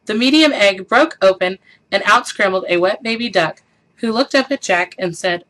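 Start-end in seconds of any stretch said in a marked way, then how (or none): none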